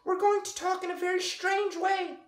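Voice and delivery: very high squeaky cartoon mouse with a laugh; giggly high cartoon mouse; Mickey Mouse voice. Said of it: "or you could do Mickey Mouse".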